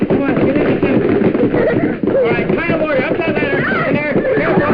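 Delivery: high, loud, rushing orders; yelling over the chaos; frantic shouting. Pretend. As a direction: herd them up the ladder in a panic. Hurrying crew aboard.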